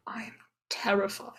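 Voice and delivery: unvoiced terrified whisper. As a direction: whisper it scared. Whispered reaction.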